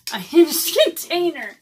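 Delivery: breaks into a real laugh on the word; laughing through the correction; a loud laughing burst mid-phrase. Real, not acted. Laughs at her own stumble.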